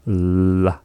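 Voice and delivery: La with air blown past the tongue; lateral fricative. Shown as a demonstration. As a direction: breathe La out the side. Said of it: "That is the L H".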